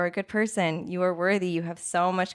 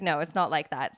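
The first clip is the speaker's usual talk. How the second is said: ordinary delivery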